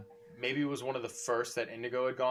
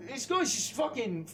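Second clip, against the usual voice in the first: put-on annoyed drunk stranger; 11 semitones higher than usual. Stranger complaining to the barmaid about Kaz. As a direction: call the barkeep over, indignant.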